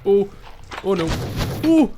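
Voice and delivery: high, loud, drawn-out cry; shocked. Real, not acted. Locked in with a mob.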